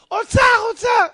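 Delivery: high, loud acted cries; frenzied excitement. Husband digs under the oven for treasure, frenzied excitement. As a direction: shout like a man obsessed.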